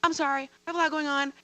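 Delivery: extremely apologetic. Retold or imitated. Teacher apologizing to superiors while bawling, flustered and apologetic. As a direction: imitate yourself apologizing in a rush. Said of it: "I kept being extremely apologetic".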